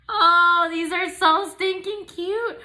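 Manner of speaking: gasp, then high slow squeals; overwhelmed with cuteness; high drawn-out gushing. Real, not acted. Seeing bows.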